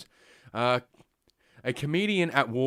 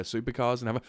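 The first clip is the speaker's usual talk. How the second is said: ordinary talk, nothing marked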